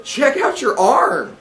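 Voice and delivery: put-on excited, high, drawn-out kid voice; high-pitched urgent friend; Trevor voice. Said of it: "I'm gonna kick in with the Trevor voice".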